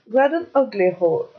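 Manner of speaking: ordinary delivery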